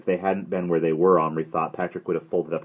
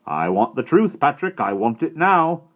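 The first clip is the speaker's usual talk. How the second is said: stern demand; 9 semitones higher, 9 dB louder than usual. A narrator's reading. Headmaster demanding truth.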